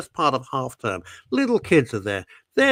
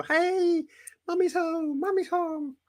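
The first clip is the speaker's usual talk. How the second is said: much higher, tiny stick insects greeting mummy; squeaky insect voice; 3 dB quieter, slower than usual. Pretend. Host voices caller's stick insects welcoming her home, high squeak. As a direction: pipe up like excited little insects. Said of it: "the insects go".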